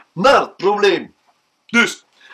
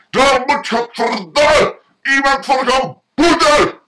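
higher, louder cursing rant; angrier shouting; 6 semitones higher, 3 dB louder than usual. Acted. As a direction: yell it, even more furious. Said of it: "wordt nog kwader en roept".

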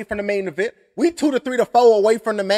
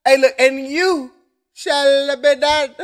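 slow, drawn-out mock villain line; mimicking Voldemort; 4 semitones higher, slower than usual. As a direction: echo the dark wizard, stretching every word. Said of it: "turning into, um, Voldemort".

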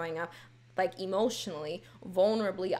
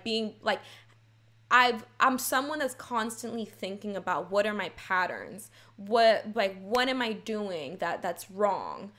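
normal talking voice

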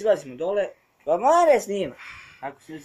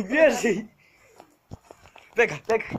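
higher, louder "run, run"; panicked yelling; 5 semitones higher, 7 dB louder than usual. Real, not acted. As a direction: shout to flee in panic. Fleeing the house.

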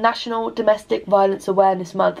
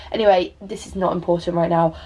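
normal talking voice

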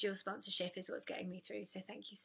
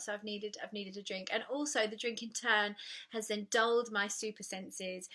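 normal talking voice

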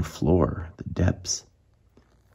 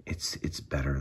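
hushed unvoiced whisper, sharing a secret; 2 semitones lower, 10 dB quieter than usual. Real, not acted. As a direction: breathe it like a secret. Secret aside to viewers.

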